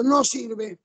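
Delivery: ordinary talk, nothing marked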